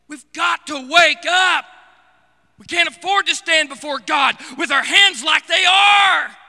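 thundering sermon call to wake up; fiery preaching. Acted. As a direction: preach it hard to wake the church. Preaching at full force.

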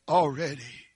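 usual speaking voice

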